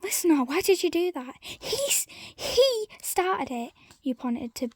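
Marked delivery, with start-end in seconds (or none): 0.0-1.4 angry demand. Acted. Rachel confronting. 1.4-3.7 high, heated, slow protest; blaming Billy while still angry. Acted. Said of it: "visibly angry".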